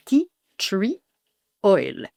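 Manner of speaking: slow English "tea tree oil"; slow English pronunciation. Shown as a demonstration. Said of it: "un ingrédient qui s'appelle le tea tree oil".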